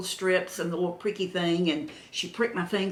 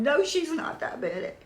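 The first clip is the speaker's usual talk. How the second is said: husband's voice done much higher, put-on; imitating Jim; 10 semitones higher than usual. Husband answering the nurse for her, high put-on voice. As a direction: mimic him backing her up in a raised voice. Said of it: "And Jim is going".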